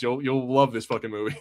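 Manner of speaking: normal talking voice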